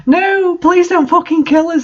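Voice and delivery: high, hammed-up begging for their lives; overacted high-pitched plea for mercy; mock pleading. A narrator's reading. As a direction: squeal out a melodramatic plea. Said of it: "they pleaded".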